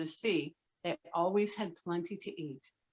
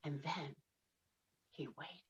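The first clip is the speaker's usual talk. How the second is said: hushed; 5 semitones lower, 15 dB quieter than usual. Acted. Keeping the fish from being scared, hushed. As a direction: drop to a near hush. Quiet suspense while fishing.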